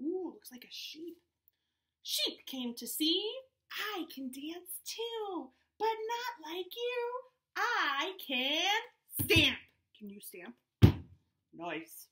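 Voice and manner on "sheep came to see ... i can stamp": lively storybook read, voices up high; animated read-aloud to a Zoom class; reading to children. Acted. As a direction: read it out bouncy for little kids. Reads picture book aloud to kids.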